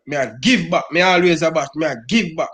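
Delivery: ordinary talk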